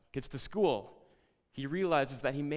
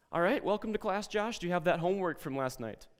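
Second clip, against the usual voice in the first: high, loud mock welcome; sarcastic teacher; 8 semitones higher, 5 dB louder than usual. Teacher greeting late Josh, high and pointed.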